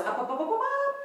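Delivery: climbing pitch, up up up; rising high cue. Real, not acted. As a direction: lift the voice sky-high with each up. Cueing rise onto the toes.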